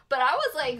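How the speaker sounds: ordinary talk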